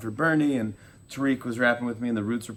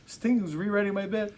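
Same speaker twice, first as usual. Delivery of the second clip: freaking out; 11 semitones higher than usual. Retold or imitated. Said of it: "Arthur's freaking out".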